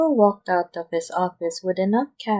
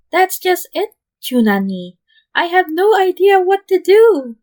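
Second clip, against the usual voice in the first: frantic high shout; near-screaming in panic; half scream; 12 semitones higher, 11 dB louder than usual. A narrator's reading. Teen Lambo half-screams to his boss, panicked. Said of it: "the cow half screamed".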